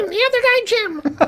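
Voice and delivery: very high squeaky helium voice. Shown as a demonstration. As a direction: squeak it out like you inhaled helium.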